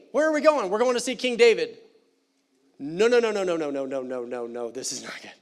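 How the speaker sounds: mock panic as he's carried in, sinking at the end; panicked protest. Mephibosheth protesting being carried, mock panic fading.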